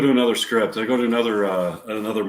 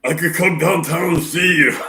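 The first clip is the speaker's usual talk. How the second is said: Al Pacino impression; 6 semitones higher, 5 dB louder than usual. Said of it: "get his voice down".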